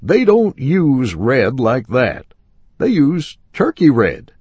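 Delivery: a lightly voiced stubborn salesman. A narrator's reading. Salesman insists, voiced high.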